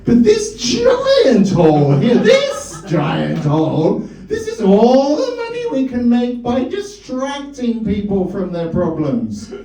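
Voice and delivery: high, slow, hammed-up German accent with an Austrian tinge; bad German accent. Father lecturing his children at the family dinner, in a bad German accent. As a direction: play a pompous Viennese doctor explaining to his kids in a bad German accent. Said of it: "in a bad German accent".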